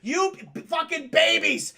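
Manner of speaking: high, loud stammered yell; stammer, then draw out the yell; mock raging shout. Villain torching the citizens, mock raging shout.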